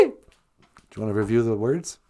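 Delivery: deep fast put-on voice; low put-on voice. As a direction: drop into a low, quick comic voice.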